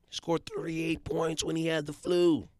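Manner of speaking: ordinary talk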